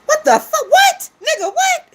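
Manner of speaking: real shock at the claim; shocked outburst. Real, not acted. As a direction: shriek it in disbelief.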